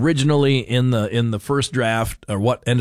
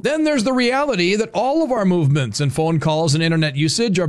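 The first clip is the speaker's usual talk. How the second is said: higher-pitched, punchy announcer read; scripted radio commentary; 6 semitones higher than usual. Acted. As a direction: deliver a prepared segment without letting up. Reads his prepared commentary segment.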